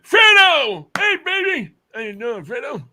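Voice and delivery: put-on high, loud old man greeting. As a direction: call out like a cheery old man. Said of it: "use my old man voice".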